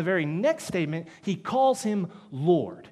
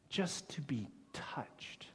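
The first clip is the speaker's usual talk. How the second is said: whisper; 3 semitones lower, 14 dB quieter, slower than usual. Real, not acted. Tender climax of mercy point.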